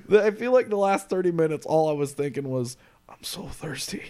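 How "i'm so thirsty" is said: breathy whisper of a parched thought; a dry, whispered inner thought. Retold or imitated. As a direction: whisper it like you're dying of thirst. Retelling his thirst at the show.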